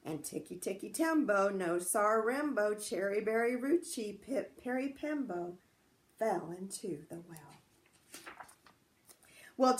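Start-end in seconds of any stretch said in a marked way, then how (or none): none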